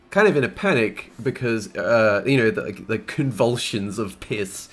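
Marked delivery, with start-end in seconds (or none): none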